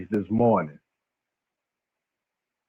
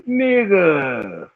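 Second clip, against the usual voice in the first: put-on West Coast accent; 6 semitones higher than usual. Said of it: "Man, y'all accent".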